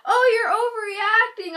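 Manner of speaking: high, loud whiny imitation; mock dismissive friend. Friend to panicking girl, exaggerated high dismissal. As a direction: mimic a scolding friend.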